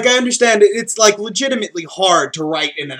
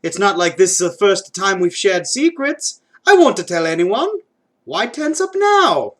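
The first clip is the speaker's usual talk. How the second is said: put-on coaxing Mario accent, swooping high; exaggerated, persuasive Mario-style Italian accent; 3 semitones higher than usual. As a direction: coax a friend in a hammed-up Mario accent. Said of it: "In an attempt to relax him".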